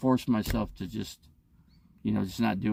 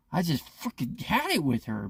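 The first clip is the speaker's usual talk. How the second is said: normal talking voice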